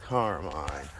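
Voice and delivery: ordinary talk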